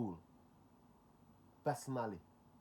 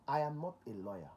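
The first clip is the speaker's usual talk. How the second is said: ordinary talk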